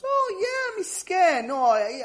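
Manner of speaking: high-pitched mock "oh yeah"; acted wistful recollection. Student remembering his late neighbour, imitated in a high, loud voice.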